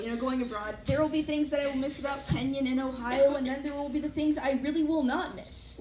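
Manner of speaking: usual speaking voice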